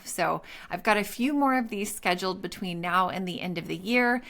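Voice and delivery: normal talking voice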